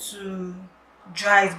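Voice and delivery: ordinary talk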